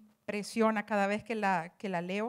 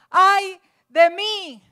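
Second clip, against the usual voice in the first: high, loud, slow drawn-out wail; anguished cry; 7 semitones higher, 12 dB louder, much slower than usual. Retold or imitated. Prophet crying out before God's throne, a loud slow wail. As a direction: cry it out in dread, stretching every word. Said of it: "Él dice".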